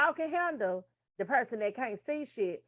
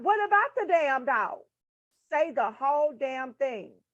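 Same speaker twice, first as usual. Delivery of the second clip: exasperated demand; 2 semitones higher, 5 dB louder than usual. Acted. Hard-of-hearing listener wants the whole sentence, exasperated loud demand. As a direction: demand the rest of it, loudly. Exasperated reply to the bad repeat.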